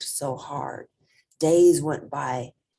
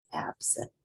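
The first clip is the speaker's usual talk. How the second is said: ordinary delivery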